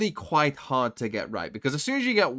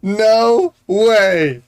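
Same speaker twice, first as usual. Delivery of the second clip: loud stunned no way; excited disbelief; 6 semitones higher, 13 dB louder, much slower than usual. Real, not acted. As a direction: yell it out in disbelief. Streamer reacts to clutch.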